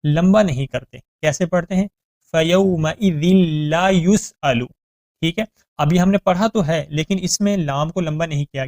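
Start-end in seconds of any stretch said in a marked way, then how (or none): 2.3-4.7 slow melodic tajweed chant; Quran recitation. Shown as a demonstration.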